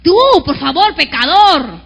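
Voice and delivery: loud scornful sneer; contemptuous jeer. Retold or imitated. The voice of contempt jeering at a sinner, loud. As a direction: spit out the word pecador. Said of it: "Es una voz del menosprecio".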